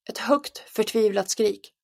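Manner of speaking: ordinary delivery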